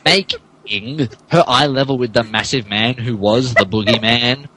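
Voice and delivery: ordinary delivery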